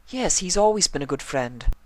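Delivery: high, ladylike pony voice; Rarity voice. A narrator's reading. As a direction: answer brightly as Rarity. Rarity answers.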